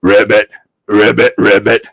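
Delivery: low frog croak. Acted. Frog in the story.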